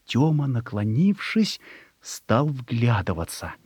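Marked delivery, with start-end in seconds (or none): none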